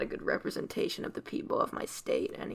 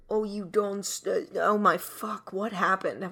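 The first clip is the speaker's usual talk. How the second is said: failed Aussie slipping into British, getting louder; accidental British accent; 6 dB louder, slower than usual. Pretend. Improviser wondering at a wrong accent, loud accidental British. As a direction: slide into fake British, baffled. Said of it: "did I become British".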